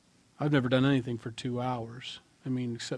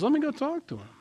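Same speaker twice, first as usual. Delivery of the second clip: eager Paul; 13 semitones higher, faster than usual. Acted. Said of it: "Paul says".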